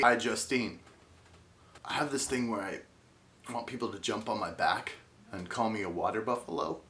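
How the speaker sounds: ordinary talk, nothing marked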